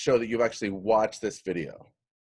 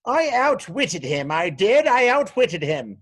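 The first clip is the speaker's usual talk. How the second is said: high, loud sing-out boast; gloating trickster; 6 semitones higher, 6 dB louder than usual. Acted. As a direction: crow over outwitting him. Acts out gloating outwitter.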